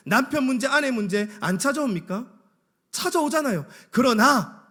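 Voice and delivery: high, loud volley of rhetorical questions; forceful preaching. Real, not acted. Preacher asks congregation about problems in their lives, urgent and forceful. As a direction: press each question on the congregation.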